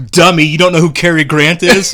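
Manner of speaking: mock scolding. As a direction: jeer like an incredulous online critic. Mimics scolding commenters.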